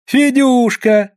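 high, drawn-out pet name; high lilting greeting; calling sweetly. A narrator's reading. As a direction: sing out his name slowly and high. Wife greets arriving husband.

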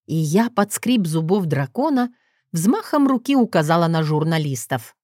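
usual speaking voice

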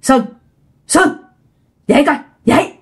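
short separated calls, rising at the end; calling to wake someone. Retold or imitated. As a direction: imitate a ghost woman calling a sleeper awake. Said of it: "bả kêu con".

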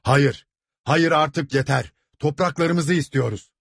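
high-pitched angry protest; deafening outcry. A narrator's reading. Crowd interrupts the steward, crying out. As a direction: cut him off with a crowd's cry. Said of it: "Kulakları sağır eden sesler".

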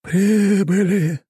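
high, very slow eerie djinn voice; grave-like voice. Acted. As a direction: speak like a cursed djinn from the tomb. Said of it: "за могильным голосом".

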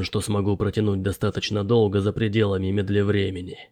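low, soft, reluctant professor; elderly professor. A narrator's reading. Professor admits he's too old for the climb, low and subdued. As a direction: explain the obstacles low and softly.

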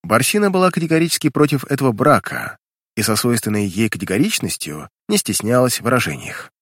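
usual speaking voice

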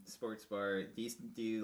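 normal talking voice

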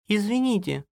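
high, polite woman's interjection; timid wife. A narrator's reading. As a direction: cut in politely, pitched high. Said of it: "вмешалась Алиска". (Алиса робко, высоким голосом)

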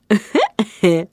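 short sly chuckle. A narrator's reading. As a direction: give a little knowing laugh. Sly gift giving.

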